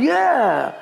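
long drawn-out high "yeah"s; imitated crowd yelling. Caught up in the yelling line, mock crowd holler. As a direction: egg the mob on with mock cheers. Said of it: "They're all yelling".

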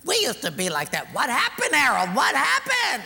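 wife's plea. Retold or imitated. Wife pressing husband in the car, rising plea. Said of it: "the wife says".

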